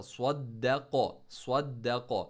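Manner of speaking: स्वद्दक़ो with a doubled, stressed द; tajweed recitation. Shown as a demonstration. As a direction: press down hard on the द in स्वद्दक़ो. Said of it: "स्वाद को दाल के साथ". (स्वद्दक़ो में द पर ज़ोर देकर शद्दा)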